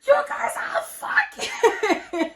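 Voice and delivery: high, loud mock shock cracking into laughter; imitating her outburst while laughing; laughing retelling. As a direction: drag it out high, losing it to laughter. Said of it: "I shouldn't be laughing".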